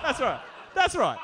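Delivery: excited. Real, not acted. Repeated agreement, rising excitement.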